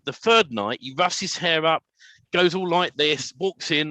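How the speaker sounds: normal talking voice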